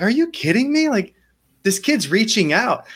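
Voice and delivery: can't believe what you just saw; outraged disbelief. Retold or imitated. Outraged at the coach.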